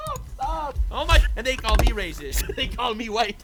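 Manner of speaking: high-pitched disbelieving laughter; cracking up. Real, not acted. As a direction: protest helplessly between bursts of laughing. Cracking up at the man's name.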